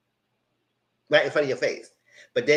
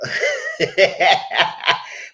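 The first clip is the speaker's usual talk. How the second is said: loud breathy cackle; big burst of laughter; laughing; 2 semitones higher, 4 dB louder than usual. Real, not acted. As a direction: crack up at your own joke. Laughs at own remark.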